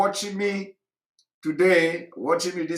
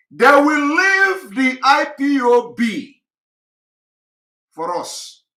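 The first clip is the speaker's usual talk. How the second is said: loud, high, drawn-out LEAVE; high-pitched, forceful refusal easing off at the end; defiant declaration; 9 semitones higher, 8 dB louder, slower than usual. Retold or imitated. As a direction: slowly proclaim it at raised volume, defiantly. Defiant retort to advisers, then repeated.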